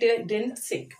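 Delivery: plain reading voice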